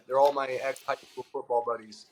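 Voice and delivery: ordinary talk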